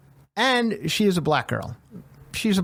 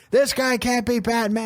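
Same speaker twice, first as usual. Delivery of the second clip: higher-pitched disbelieving protest; mock freak-out; 8 semitones higher than usual. Imitating people freaking out over new Batman, mock outrage. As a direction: exclaim it like an indignant fan. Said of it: "people are freaking out about that".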